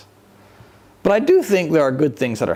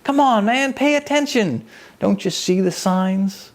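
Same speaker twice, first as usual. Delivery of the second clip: higher, exasperated voice of the Lord scolding fools; the Lord chiding; 8 semitones higher than usual. Pretend. Lord scolding people who miss the signs, higher and exasperated. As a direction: shake them awake like a fond but impatient teacher. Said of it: "Would he say".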